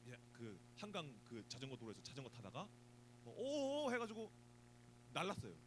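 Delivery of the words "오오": high mock oh-oh of surprise; surprised cry. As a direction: yelp as if losing balance. Flying off a bike.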